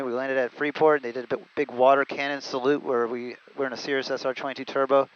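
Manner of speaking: ordinary delivery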